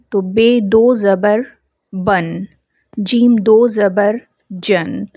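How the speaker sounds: slow, syllable by syllable, ending in a clear nasal n; slow Urdu spelling drill, tanween read as बन and जन. Shown as a demonstration. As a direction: spell out बे दो ज़बर slowly, then land on बन with the nun sound. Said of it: "जैसे बे के ऊपर तनवीन है".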